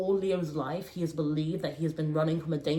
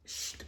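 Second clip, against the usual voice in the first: shushing sound; 14 dB quieter than usual. Retold or imitated. Gesture of silencing.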